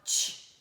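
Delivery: "tch" as a short voiceless burst; demonstrated tch sound. Shown as a demonstration. Teacher showing how we say T, isolated "tch". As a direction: release "tch" with no vowel. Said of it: "Quando a gente fala tch".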